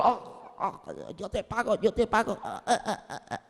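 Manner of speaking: very soft, strangled gasping; acted choking, fading to gasps; being choked. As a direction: squeeze out words as if throttled. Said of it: "No podía ni hablar porque el otro lo tenía por el cuello".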